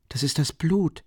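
usual speaking voice

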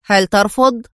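mild firmness, interrupting; firmly. A narrator's reading. Adham cutting Tino off, understated firm demand. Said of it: "قاطعه أدهم في حزم".

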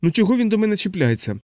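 high, aggrieved wail; mildly hammed-up lament; wailed complaint. A narrator's reading. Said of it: "заголосила вона".

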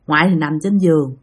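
usual speaking voice

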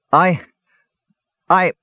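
halting, high starts with long gaps; shaken, broken phrases; faltering. A narrator's reading. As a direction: stammer to a stop, then go on slowly. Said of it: "Palin faltered".

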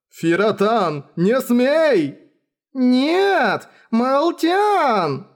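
high, drawn-out cries; frantic forbidding. Acted. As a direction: call out in desperation to stop them. Desperately forbidding the two girls.

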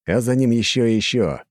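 usual speaking voice

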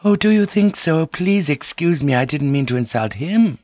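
very high, apologetic lady; submissive, wide-eyed apology; meek, flattering noblewoman. A narrator's reading. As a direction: fawn in a high feminine voice.

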